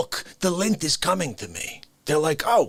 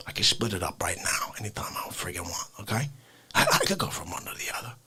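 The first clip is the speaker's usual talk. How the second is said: intense whisper; 9 semitones lower, 6 dB quieter, faster than usual. Acted.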